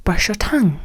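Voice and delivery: hushed whisper. Acted. Telling viewers to brush, in a whisper. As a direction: say it like a secret.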